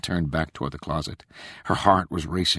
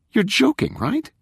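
disbelieving; 3 dB louder than usual. A narrator's reading. Rachel stares in disappointment.

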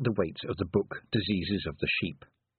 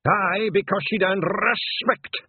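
high, loud, slow brogue; ringing Scottish rebuke; 10 semitones higher, 6 dB louder, slower than usual. A narrator's reading. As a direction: let it ring round the stones. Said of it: "voice seemed to echo around the stones".

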